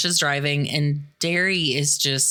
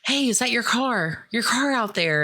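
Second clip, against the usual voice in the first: waitress; 7 semitones higher than usual. Retold or imitated. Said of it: "the waitress is like".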